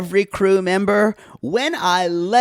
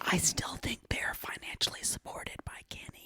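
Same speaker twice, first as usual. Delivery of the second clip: hushed, voiceless whisper; very soft gossipy whisper; 3 semitones higher, 19 dB quieter than usual. Real, not acted. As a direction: whisper it like a confidential aside.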